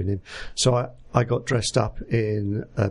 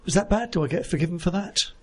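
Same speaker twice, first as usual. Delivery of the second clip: higher-pitched playful innocence; mock sheepish; 7 semitones higher than usual. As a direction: plead jokingly, pitched up like a naughty child. Joking guilt after telling his mishap.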